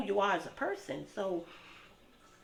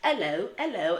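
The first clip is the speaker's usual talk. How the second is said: playful put-on British hello, louder; a light mock British accent, louder; 4 semitones higher, 7 dB louder than usual. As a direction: greet like a Brit, lightly hammed. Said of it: "I'm gonna be talking like them".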